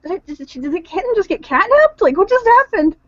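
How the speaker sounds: high, stammering disbelief; baffled. Acted. Bar owner asks Alex about the catnapped kitten, bewildered. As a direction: start hushed and faltering, climb to a high puzzled question.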